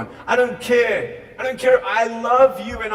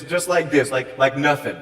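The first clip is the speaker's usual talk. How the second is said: normal talking voice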